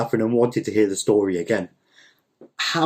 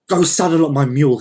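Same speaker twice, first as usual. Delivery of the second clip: loud, higher-pitched command; ordering; 4 semitones higher, 5 dB louder than usual. Acted. Don Lol to the farmhand, ordering. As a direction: give the order sharply and briskly. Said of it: "he ordered to the farmhand".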